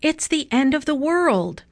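high dramatic storybook alarm; understated shout of panic; alarmed cry. A narrator's reading. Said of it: "shouted Missus Watson".

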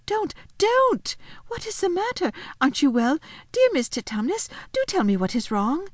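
put-on high, pleading little girl; great distress. A narrator's reading. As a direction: beg him anxiously to stop. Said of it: "said Lucy in great distress".

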